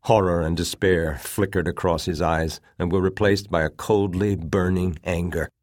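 ordinary delivery

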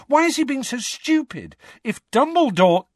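high, urgent desperate outburst. A narrator's reading. Harry demanding why the Minister is stupid, desperate. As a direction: cut off mid-plea, desperate and high. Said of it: "said Harry desperately".

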